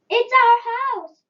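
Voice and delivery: high, loud, squeaky mouse voice. A narrator's reading. Mother mouse squeaking to George about her burnt house. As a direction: squeak it like a frantic tiny mouse. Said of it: "squeaked the mother mouse".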